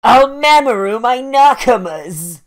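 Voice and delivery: loud, high hammed-up lament; mock anguished cry. Burning orc crying out for his comrades, mock anguish. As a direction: wail it out like an overdramatic anime victim. Mock wail of a fallen character.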